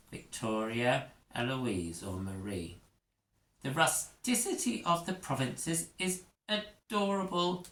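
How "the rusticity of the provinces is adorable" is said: high, airy royal voice; spoiled princess charmed by the provinces; haughty princess. Acted. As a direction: condescend sweetly to the peasants.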